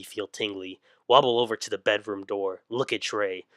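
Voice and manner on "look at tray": ordinary delivery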